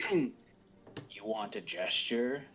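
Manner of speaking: slow, soft and menacing; drawn-out quiet threat. Acted. Character menacing before shooting.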